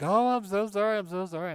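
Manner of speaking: high, loud flustered apology. Retold or imitated. Mom apologizing to her son after the crash, high flustered. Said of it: "she was like".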